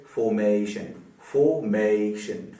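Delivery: Formation split slowly into three syllables. Shown as a demonstration. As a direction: stretch formation out as fo, may, shun. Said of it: "तीन syllable वाला हो गया". (Formation को तीन हिस्सों में धीरे-धीरे)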